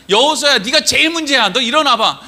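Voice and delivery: loud, high, pointed telling-off; single someone out with mock blame; accusing scolding. God blaming Joshua as the problem, high loud scolding. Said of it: "이렇게 하는 게 아니라".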